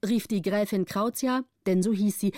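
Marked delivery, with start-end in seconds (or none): none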